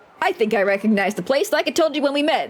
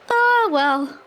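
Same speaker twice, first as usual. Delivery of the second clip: resigned; 6 semitones higher than usual. Real, not acted. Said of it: "Sucks to be me".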